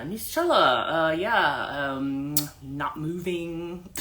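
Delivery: very slow, careful helper voice; slow explaining helper. Retold or imitated. Helper explaining the new name, very slow voice. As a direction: spell out the meaning at a crawl.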